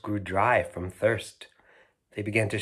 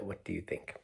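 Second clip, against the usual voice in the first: ordinary delivery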